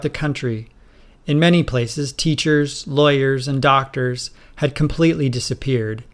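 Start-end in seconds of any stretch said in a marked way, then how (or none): none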